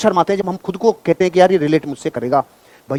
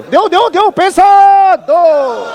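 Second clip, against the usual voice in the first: much higher and louder, the last words stretched very slowly; loud demand; 10 semitones higher, 4 dB louder, much slower than usual. Shown as a demonstration. Coach acting out a salesman demanding money, loud and drawn out. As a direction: hammer the demand home. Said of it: "मुझे बोलो".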